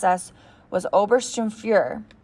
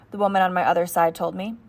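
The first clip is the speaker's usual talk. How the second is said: usual speaking voice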